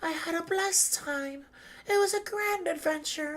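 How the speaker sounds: high, soft gentle farewell; a hint of a fond ship's voice; lightly voiced dying ship. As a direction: say goodbye like a content old friend. Voicing the ship Going Merry.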